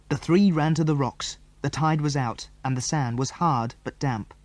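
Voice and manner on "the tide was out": ordinary delivery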